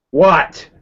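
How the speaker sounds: muffled shout behind a door; muffled through a door. Acted. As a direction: call it through a closed door.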